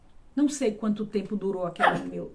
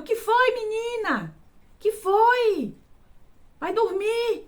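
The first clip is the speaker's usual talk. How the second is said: calling back; 11 semitones higher, much slower than usual. Retold or imitated. Children's mother answering the girl's scream, calling back. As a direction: answer a shout from the next room.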